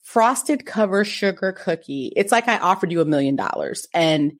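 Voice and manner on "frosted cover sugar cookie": slow, emphatic eye-roll delivery; mock exasperation. Wife retelling her disbelief at husband's refusal, slow and exasperated. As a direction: spell it out slowly, as if he cannot grasp it. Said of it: "insert eye roll".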